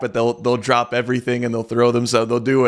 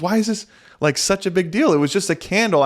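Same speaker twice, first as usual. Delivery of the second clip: higher mock wife voice, puzzled and defensive; imitating his wife; 7 semitones higher than usual. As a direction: play the wife shrugging it off, pitched up. Wife answers husband's scolding.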